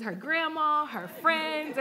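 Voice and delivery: put-on a toddler eagerly checking on her brother; little daughter's voice. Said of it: "And she goes".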